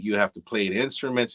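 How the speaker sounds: ordinary talk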